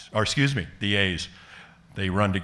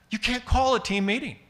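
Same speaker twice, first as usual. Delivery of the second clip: mock yell, much higher; imitated scream; 13 semitones higher, 3 dB louder than usual. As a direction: scream it up like the furious manager. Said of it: "screaming at the top of his lungs".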